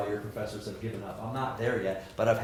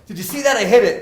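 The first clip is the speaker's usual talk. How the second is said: much higher and much louder, crowing; a kid yelling at the coach about his hit; excited kid; 11 semitones higher, 14 dB louder than usual. Retold or imitated. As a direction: shout it like a proud five-year-old. Five-year-old thrilled by his hit.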